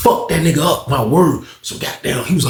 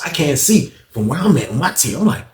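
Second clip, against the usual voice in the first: ordinary delivery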